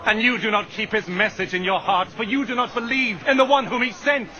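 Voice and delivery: ordinary delivery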